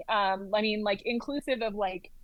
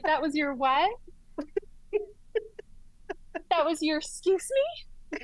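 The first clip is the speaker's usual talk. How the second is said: stunned disbelief; 5 semitones higher than usual. Retold or imitated. Shocked the fellow never did one.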